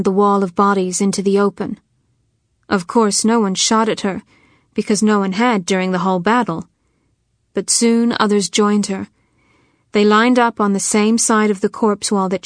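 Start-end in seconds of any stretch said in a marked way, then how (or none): none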